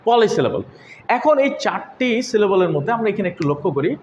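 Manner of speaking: ordinary delivery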